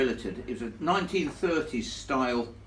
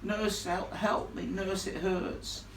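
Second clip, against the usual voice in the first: dying lad calling for a nurse; 5 semitones higher, 5 dB quieter, much slower than usual. Retold or imitated. Retelling a dying youth calling in the night, lightly moaned. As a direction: quietly moan nurse like a hurt lad. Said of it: "all I could hear him going was".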